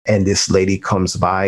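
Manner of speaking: usual speaking voice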